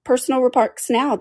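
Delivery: high, gloating retort; high-voiced point-scoring; triumphant. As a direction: catch them out, pitched high. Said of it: "said the Hatter triumphantly".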